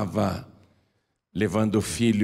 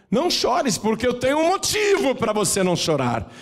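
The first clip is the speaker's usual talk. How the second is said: high urgent plea; 13 semitones higher than usual. Acted. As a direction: lift it high and press the promise. Jesus voiced with force.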